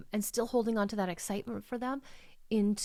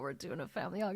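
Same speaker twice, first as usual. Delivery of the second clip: ordinary talk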